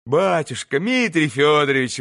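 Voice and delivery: plain reading voice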